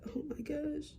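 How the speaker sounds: hushed, whispery amazement; whispered awe. Retold or imitated. Vlogger reliving being able to smell stuff, whispered awe. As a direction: whisper it in relieved wonder. Retold relief at smelling again.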